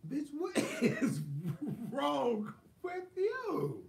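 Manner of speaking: high, slow, laughing disbelief; teasing friend through laughter; laughing question. Retold or imitated. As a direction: ask it while cracking up. Asking sick cousin, laughing.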